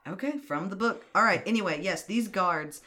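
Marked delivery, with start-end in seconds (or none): none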